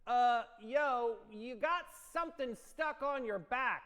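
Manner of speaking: put-on loud kid call-out; voice of doom. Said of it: "the voice of doom".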